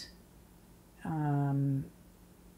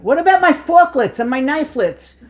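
joking quip; 9 semitones higher, 9 dB louder than usual. Retold or imitated. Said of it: "they said I was so funny".

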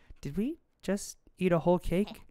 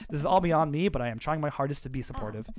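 ordinary talk, nothing marked

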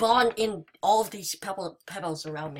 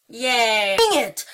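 ordinary talk

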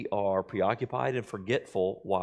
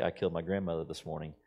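low and soft, quick; 6 semitones lower, 6 dB quieter, faster than usual. Retold or imitated. FDR to the Bolivian ambassador, low and quick. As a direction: murmur it casually. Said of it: "in a low voice".

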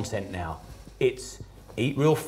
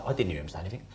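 small timid mumble, much softer; frightened kids' quiet denial, much lower and softer; scared friends' reply; 9 semitones lower, 10 dB quieter, much faster than usual. Retold or imitated. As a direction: shrink away and barely say it. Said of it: "my friends would be like".

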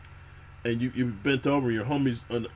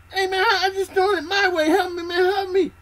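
very high, loud, near-crying begging; desperate crying plea; 7 dB louder than usual. Retold or imitated. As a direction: beg like you're drowning in panic.

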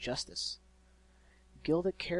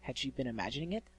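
ordinary delivery, nothing marked

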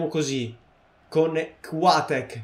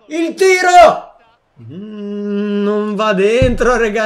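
shouted, much higher, slow and stretched; breathless italian goal commentary; 5 semitones higher, 10 dB louder, much slower than usual. Acted. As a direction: call the shot like a TV commentator. Commentating a shot. (telecronaca concitata da gol)

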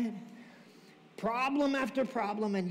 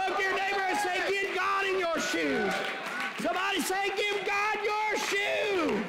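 full-force preaching; 8 semitones higher than usual. Acted. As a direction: draw out each word like a rallying cry. Preacher directing congregation.

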